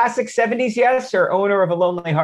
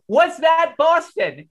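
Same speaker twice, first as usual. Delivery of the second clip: put-on slow, much higher, desperate kid asking the usher; frantic question; 9 semitones higher, 3 dB louder, slower than usual. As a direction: plead to the usher, pitch shooting up. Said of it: "I grab the usher frantically".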